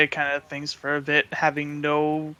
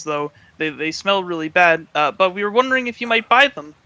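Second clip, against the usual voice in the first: put-on higher and louder, a bubbly young girl's voice; cheery Faye; 3 semitones higher, 6 dB louder than usual. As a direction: chatter nervously but sweetly. Faye pitches pelts to worker.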